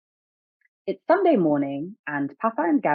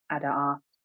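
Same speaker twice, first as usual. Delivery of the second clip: plain reading voice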